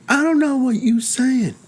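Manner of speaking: high, strained voice of a confused patient. Retold or imitated. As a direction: play the scared patient who can't follow big words. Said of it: "That's a good voice you did".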